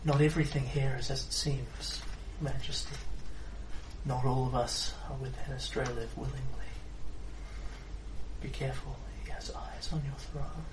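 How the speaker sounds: soft sultry whisper into an ear. Acted. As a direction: breathe it close and secret, sultry. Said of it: "in a sultry voice as she breathes into your ear".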